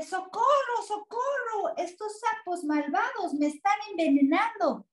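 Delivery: high wailing cry for help; panicked monster. A narrator's reading. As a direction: wail it in mock terror.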